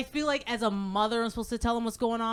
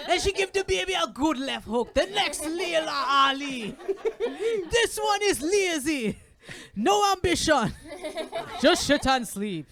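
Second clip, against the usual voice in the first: comedian's opinionated dad; 5 semitones higher than usual. Retold or imitated. Dad comparing granddaughter with lazy baby, hammed-up verdict. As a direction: pronounce judgement as a proud grandpa. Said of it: "My dad's just like".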